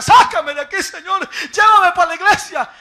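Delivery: loud, high desperate cry to be saved; screaming from hell. Retold or imitated. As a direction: cry out like a soul in torment. (grito desesperado desde el infierno)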